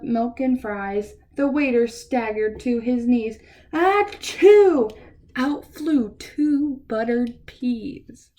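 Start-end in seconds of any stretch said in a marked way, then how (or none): 3.7-4.9 loud, high mock sneeze; a big hammed-up achoo. Reading to kids, the food-covered waiter sneezing out peas.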